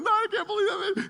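high, loud, laughing disbelief; excited friend. Retold or imitated. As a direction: squeal it like a thrilled fisherman. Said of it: "Jared is going nuts".

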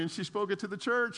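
ordinary delivery

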